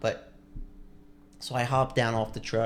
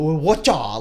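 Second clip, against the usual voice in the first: higher, louder mock martial-arts shout; mock karate cry; 4 semitones higher, 8 dB louder than usual. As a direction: throw out a loud joking karate yell. Mimics mocking karate cries.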